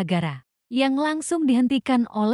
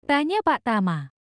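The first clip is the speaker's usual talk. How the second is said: usual speaking voice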